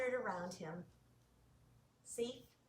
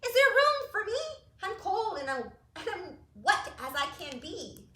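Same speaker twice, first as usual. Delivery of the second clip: much higher, louder pleading pig; 7 semitones higher, 6 dB louder than usual. Acted. Pig begs Blue for room, high and pleading. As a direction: beg to be let in, cold and wet. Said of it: "said pig".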